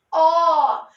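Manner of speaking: a loud, held "ó" on its own; emphatic teacher's "ó"; isolated stressed syllable. Shown as a demonstration. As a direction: sing out the single syllable "ó" firmly and long.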